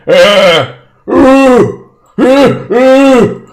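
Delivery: high, loud giant wailing; moaning wail. Acted. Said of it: "he starts moaning".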